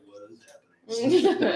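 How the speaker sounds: slow, baffled and laughing; puzzled onlooker, high and slow. Retold or imitated.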